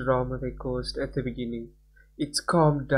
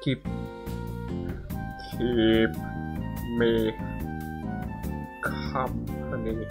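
low, drawn-out snake voice; 5 semitones lower, 7 dB quieter, slower than usual. Acted. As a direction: hiss it like a serpent. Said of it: "I gave him a Cerberus snake voice".

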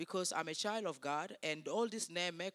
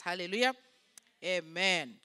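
loud, punched call for a response; congregational praise call; 10 dB louder than usual. Real, not acted. Preacher prompting the congregation to answer, loud praise call. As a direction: call it out to the whole church.

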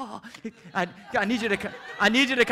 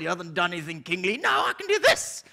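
ordinary talk, nothing marked

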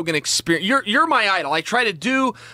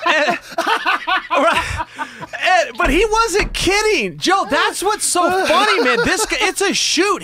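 amused and excited; 8 semitones higher, slower than usual. Real, not acted. Excited retelling, still amused.

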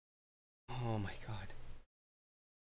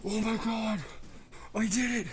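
triumphant shouting; 10 semitones higher than usual. Real, not acted. Finally beats the boss.

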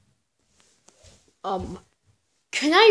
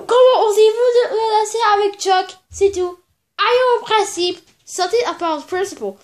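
scolding in French; 4 semitones higher, 6 dB louder, faster than usual. Acted.

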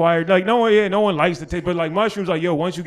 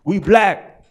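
emphatic refusal; 5 semitones higher, 8 dB louder, slower than usual. Retold or imitated.

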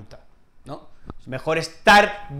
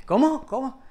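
confused listener; 7 semitones higher than usual. Pretend. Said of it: "no lo entiende".

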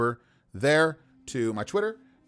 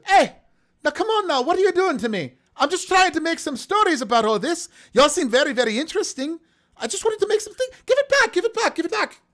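very high, loud put-on half-orc voice; protesting half-orc; 5 dB louder than usual. Half-orc begging for the book back, high and agitated. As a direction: plead loudly in a high character voice. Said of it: "the half-orc speaks up".

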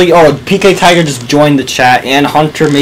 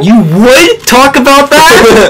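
mocking; 10 semitones higher, 5 dB louder, slower than usual. Mocking echo of friend's line.

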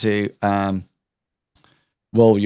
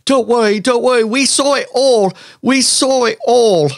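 high, emphatic bystanders; high chorus of witnesses; crowd reassurance; 11 semitones higher, 4 dB louder than usual. Acted. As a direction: rally round the victim. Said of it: "people sort of, like, say".

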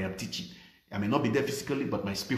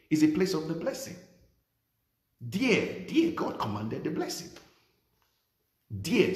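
full-force preaching; 5 semitones higher than usual. Acted. Pastor rousing congregation about the church, full-force preaching. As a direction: preach it out with fire.

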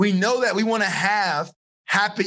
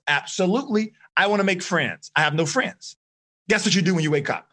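usual speaking voice

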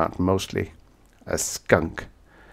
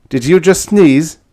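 higher, louder accusing question; accusing shout; 9 semitones higher, 9 dB louder than usual. A narrator's reading. He confronts the woman over the sneeze, loud and accusing. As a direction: fire the accusation at her.

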